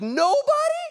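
high-pitched incredulous yell; retold yell. Preacher re-enacting his reaction to an empty altar call, incredulous yell. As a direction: yelp it out in disbelief. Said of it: "I just yelled".

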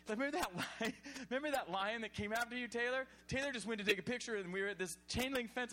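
usual speaking voice

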